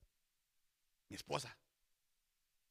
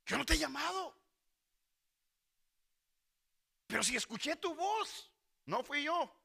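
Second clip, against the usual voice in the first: high, raised-voice denial; insistent protest; 7 semitones higher, 4 dB louder than usual. Acted. Samuel and Elí dispute who called, insistent protest. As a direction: protest in a pitched-up voice.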